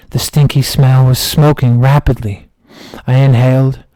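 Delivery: normal talking voice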